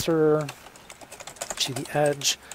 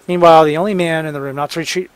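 fast re-read; 3 semitones higher, 11 dB louder, much faster than usual. Shown as a demonstration. Fast reread of draft.

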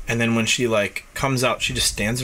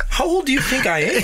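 laughing disbelief; 12 semitones higher than usual. Retold or imitated. Retelling disbelief at the officer.

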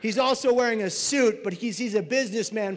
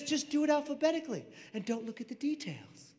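high, hushed brush-off; dismissive instruction; 5 semitones higher, 6 dB quieter than usual. Acted. Supervisor dismisses volunteer's privacy worry, soft high brush-off. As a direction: say it softly and higher, waving off the worry.

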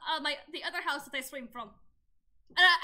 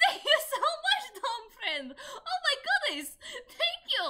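high laughing thank-you; giddy thanks; 7 semitones higher, 5 dB louder, slower than usual. Real, not acted.

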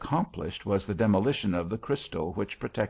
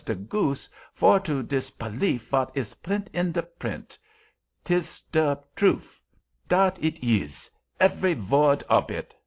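put-on higher, emphatic German-accented creature; scolding German-accented angel; 5 semitones higher, 3 dB louder than usual. A narrator's reading.

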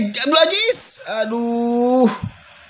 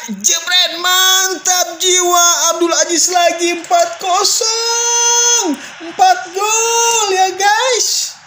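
high, shouted goal celebration; goal call; 9 semitones higher than usual. Real, not acted. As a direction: yell the fourth goal. Fourth goal scored.

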